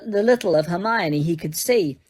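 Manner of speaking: ordinary delivery, nothing marked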